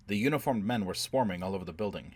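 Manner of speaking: plain reading voice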